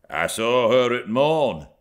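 lightly voiced country labourer; a hint of a rough farmhand. A narrator's reading.

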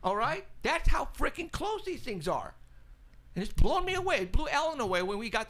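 amazed excitement. Real, not acted. Amazed how close the sightings are.

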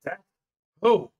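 sudden loud cat character voice; Shadow's voice. Acted. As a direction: boom the question out loud. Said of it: "let me do Shadow's voice".

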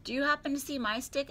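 high-pitched questioning character voice; Tigger. A narrator's reading. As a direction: ask as an eager cartoon figure. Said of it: "asked Tigger".

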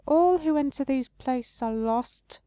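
high, slow and sorrowful; the tree face sadly answering the traveler. Acted. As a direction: mourn it slowly. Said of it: "the face replies sadly".

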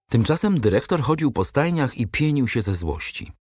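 ordinary delivery, nothing marked

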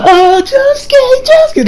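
put-on high, slow, swooning girl voice; fawning girls. Fangirls swooning over Josuke, high drawn-out coo. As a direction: coo his name like a smitten fangirl. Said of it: "the girls like".

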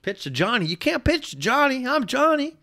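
high-pitched mock-emotional protest; hammed-up kid. Host replays the kid's huddle protest for laughs, hammed-up high voice. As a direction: push the boy's plea way up high. Replays the tearjerker line, heightened.

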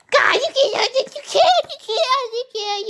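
high, loud, fading you can't; frustrated protest. Real, not acted. Player scolding friends who lead the monster to him, loud then fading. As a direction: sputter at your friends, then run out of steam. Teammates leading the monster wrong.

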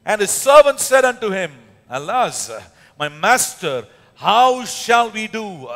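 solemn loud scripture reading; scripture read aloud. Shown as a demonstration. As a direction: proclaim the Bible passage. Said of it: "It says".

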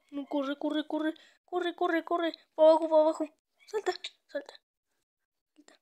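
loud frantic urging; being chased. Real, not acted. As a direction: shout while fleeing in panic. (perseguido, gritando)

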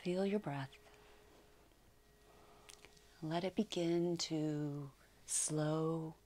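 slow, calm meditation voice with long pauses; unhurried yoga nidra guidance, settling softly; guided meditation. Acted. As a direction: ease them gently into relaxation, phrase by phrase.

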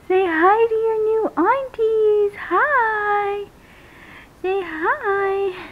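high, sing-out mommy coo. Real, not acted. Speaks to doll as a baby.

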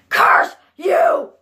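high, crying shout; angry shout. Acted. Boy cursing those who tease him, angry shout.

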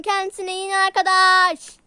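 loud shout into the ear. Acted. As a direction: yell it at her like she is deaf. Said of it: "Bağırma kulağıma sağır gibi ya". (bağırarak tekrar)

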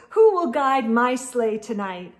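ordinary delivery, nothing marked

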